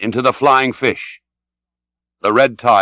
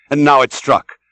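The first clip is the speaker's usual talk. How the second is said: plain reading voice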